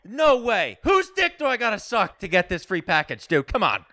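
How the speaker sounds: high, shouted, drawn-out disbelief settling toward normal pitch; over-the-top excitement. Acted. Prank caller to telemarketer about a free package, wildly excited. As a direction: go wild, pitch shooting way up.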